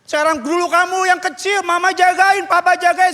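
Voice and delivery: high-pitched, insistent preaching; pleading exhortation. Real, not acted. Pastor reminding newlyweds to care for parents, pleading.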